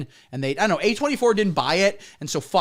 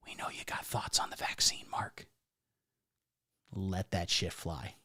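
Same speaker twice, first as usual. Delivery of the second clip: conspiratorial whisper; 9 semitones lower, 15 dB quieter than usual. Acted. Comic whispering a secret plea to Mark Ruffalo. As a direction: lean in and whisper it just to him.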